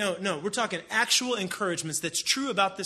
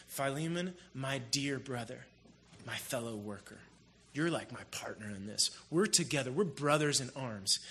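tender voice of Paul; 6 semitones lower, 7 dB quieter than usual. Retold or imitated. Paul tenderly calling Philemon his brother. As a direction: lean in gently, like a mentor praising.

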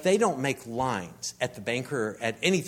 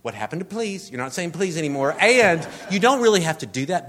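indignant retort; 5 semitones higher, 8 dB louder than usual. Retold or imitated. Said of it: "And I'm like".

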